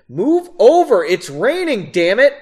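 loud, high exasperated order; irritated yell. A narrator's reading. Woman climbing in from the rain, yelling impatiently. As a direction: yell it, fed up. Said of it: "she yelled".

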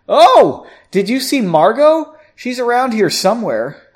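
high, loud, eager question; excited chatter. A narrator's reading. Bartender asking Henry about Margot, excited. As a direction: exclaim it as a sudden happy thought.

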